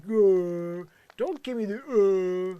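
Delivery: loud, high mocking groans; Willie/private. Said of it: "Willie, when you're like".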